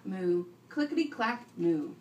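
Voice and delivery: usual speaking voice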